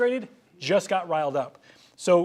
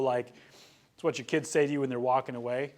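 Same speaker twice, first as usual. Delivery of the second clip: usual speaking voice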